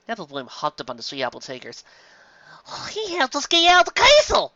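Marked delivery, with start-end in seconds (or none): 2.7-4.5 put-on very high, loud young voice; excited filly. A narrator's reading. Apple Bloom telling her sister, high and excited. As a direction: squeal it like an eager little girl.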